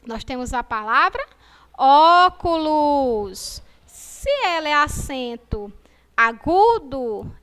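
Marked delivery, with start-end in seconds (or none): 1.8-3.6 óculos slow and loud, open ó held long; drawn-out óculos. Shown as a demonstration. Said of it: "a pronúncia vai ser fechada ou aberta?". (óculos bem devagar, com ó aberto)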